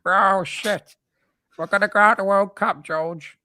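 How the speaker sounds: high, pushed puppet voice; Zippy. Acted. Zippy telling George about the World Cup, high puppet voice. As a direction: do a high, silly children's puppet. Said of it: "that was Zippy, wasn't it, though".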